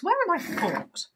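exasperated question. Shown as a demonstration.

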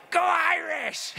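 big high shout of a fan; yelled cheer. Retold or imitated. Coach yelling on a fast break, loud high cheer. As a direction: bellow it like a coach cheering his team. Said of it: "he'd yell".

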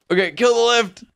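urgent call. Real, not acted. Player telling partner to kill the lift, urgent.

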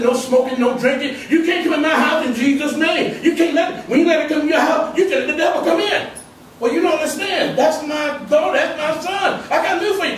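rising sermon shout; fiery preaching. Acted. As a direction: preach it hard at the congregation. Preaching to the church.

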